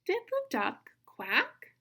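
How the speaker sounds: slow, high "Did the duck", sharp rise on "quack"; question intonation. Shown as a demonstration. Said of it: "Listen to me read it again".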